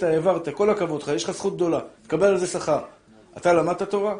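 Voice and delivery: usual speaking voice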